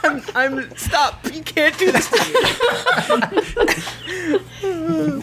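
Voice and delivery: high laughing plea; breaking up with laughter while pleading. Real, not acted. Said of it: "his voice keeps changing".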